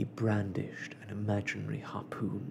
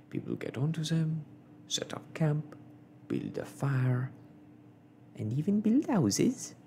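higher-pitched storytelling companion; lightly voiced servant, climbing higher; eager manservant; 5 semitones higher than usual. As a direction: build up the tall tale, rising at the end. Conseil joins the tall story.

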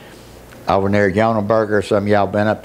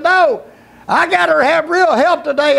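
high, raised insistent plea; desperate refusal; 3 dB louder than usual. Retold or imitated. Father refusing the voice, desperate refusal. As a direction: insist loudly at high pitch.

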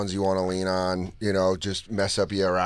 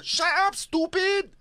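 Rosie Perez; slower than usual. Shown as a demonstration. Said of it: "Rosie Perez".

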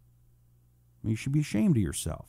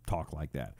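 ordinary delivery

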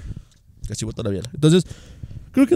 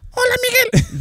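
Simpsons character. Retold or imitated. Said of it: "de Los Simpsons".

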